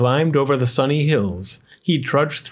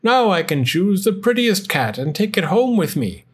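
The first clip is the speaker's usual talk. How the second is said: joyful; 7 semitones higher than usual. A narrator's reading. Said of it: "cried the old man joyfully".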